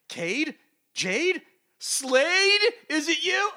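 escalating mock suspense, name by name; hammed-up dramatic reveal. Acted guessing of villain's identity.

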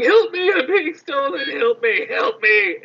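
high-pitched little cry for help; pleading in a tiny high voice; mock screaming for help. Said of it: "it's literally screaming for someone".

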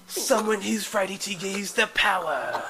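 hammed-up British gone Crocodile Dundee; bad British accent. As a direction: proclaim it slowly in a terrible British accent. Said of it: "going British or fucking crocodile".

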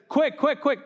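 high, hurried calling out; urgent. Retold or imitated. Father urges servants to hurry for his son.

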